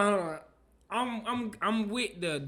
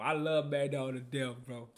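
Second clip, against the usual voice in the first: ordinary delivery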